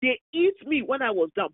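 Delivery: normal talking voice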